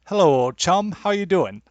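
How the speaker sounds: high-pitched, put-on British greeting; hammy attempt at a chummy Brit; bad British accent. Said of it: "I can't do a British accent".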